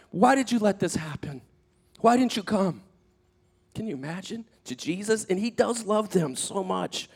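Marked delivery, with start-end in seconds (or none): 0.1-2.8 higher-pitched imploring question; acted pleading. Honest Martha questioning Jesus, pleading. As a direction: plead for an answer, voice raised in pitch.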